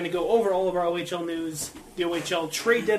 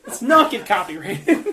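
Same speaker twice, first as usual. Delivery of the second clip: laughing; 6 semitones higher, 8 dB louder, slower than usual. Real, not acted. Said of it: "laughing after chaos".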